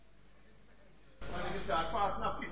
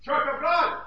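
loud, ringing pulpit voice; full-force sermon delivery; fiery preaching; 6 semitones higher, 7 dB louder, slower than usual. Acted. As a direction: preach it out to the congregation.

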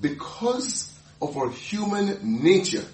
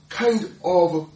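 ordinary talk, nothing marked

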